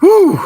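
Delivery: high yelp from the cold; cold whoop. Real, not acted. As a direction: shout out against the freezing air. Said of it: "let my hands warm up".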